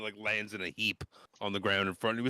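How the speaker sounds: ordinary talk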